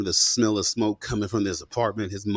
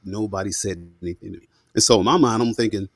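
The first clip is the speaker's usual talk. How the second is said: ordinary delivery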